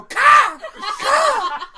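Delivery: very loud, high squawks; mocking deer caws. Old friends run up to tease the storyteller, mocking deer caws. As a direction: shriek caws like jeering friends. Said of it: "run up to me and they go".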